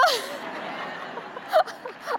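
bright laugh after her own punchline; high laugh. Real, not acted. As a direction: laugh at the joke she just made.

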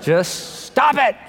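slow, laughing deadpan line; Bob Newhart. Retold or imitated. Preacher repeating the comedian's punchline, slow and amused. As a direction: draw it out through a laugh. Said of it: "I love Bob Newhart's counsel".

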